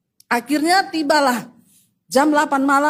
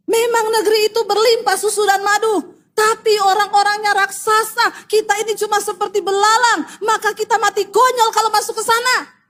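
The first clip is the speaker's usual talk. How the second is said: high, insistent declamation; dramatised alarmed report; 7 semitones higher than usual. Retold or imitated. Spies report giants in the land, dramatised alarm. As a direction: declaim it high, like a dire warning.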